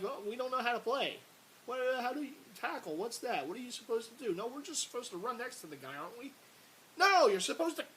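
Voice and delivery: high, loud dopey voice for the confused players; mocking clueless players. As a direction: mimic the defenders who forgot how to tackle. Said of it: "and it's like".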